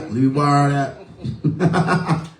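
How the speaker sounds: slow, teasing request; slow drawn out. Acted. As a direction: stretch every word out slowly. Joking banter, repeated request.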